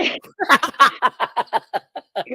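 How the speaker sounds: loud, high laugh that falls away. Real, not acted. Caller laughing after his toilet-bowl gold joke. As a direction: burst out laughing at his own line.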